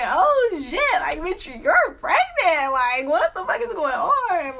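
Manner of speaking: high, loud, drawn-out shock; hammed-up panic at big news; freaking out. Friends seeing the positive test, acted freak-out.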